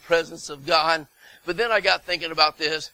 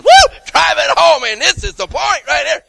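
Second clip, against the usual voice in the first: high, loud, driving emphasis; whoop, then hammer it home; emphatic whoop; 10 semitones higher, 12 dB louder than usual. Acted. Preacher acting out his underlined notes, excited shout. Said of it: "I'm thinking, all right".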